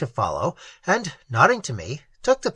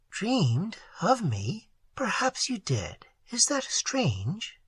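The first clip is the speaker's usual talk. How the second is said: slow soft whisper; whispered, unhurried girl's voice; hushed Isande; 5 semitones higher, 8 dB quieter, slower than usual. A narrator's reading. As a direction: breathe it to him, teasing gently.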